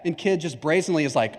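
ordinary talk

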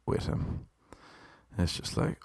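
unvoiced, hushed disbelief; whispered aside. Real, not acted. Telling listeners about the seatmate's clutter, whispered aside.

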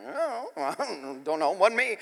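retold sheepish denial; feigned innocence. Feigns innocence to his yelling sister.

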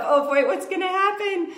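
high, squeaky, fretful; hammed-up nervous inner voice; mock panic. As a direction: mom reliving the drop-off panic. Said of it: "it was that moment of".

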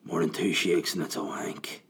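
a lightly voiced creepy old man. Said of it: "An old man".

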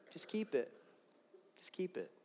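acted reluctance, said under the breath; begrudging. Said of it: "begrudging, reluctantly dropping some card".

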